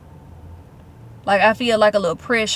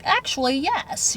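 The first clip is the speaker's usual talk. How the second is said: higher-pitched mock British accent; 5 semitones higher, 3 dB quieter than usual. Owner quoting ChatGPT's answer, imitated British accent. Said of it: "with her British accent".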